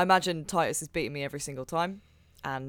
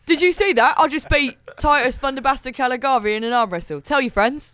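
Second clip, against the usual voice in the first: much higher, much louder triumphant crowing; gloating victory; 8 semitones higher, 9 dB louder than usual. Acted. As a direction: crow over the win to everyone. Gloating after winning arm wrestle.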